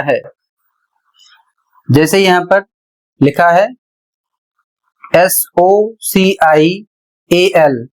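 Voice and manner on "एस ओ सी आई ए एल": slow, letter by letter; spelling out. Shown as a demonstration. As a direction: spell it slowly with gaps between letter groups. Teacher spells out a word. (धीरे-धीरे एक-एक अक्षर)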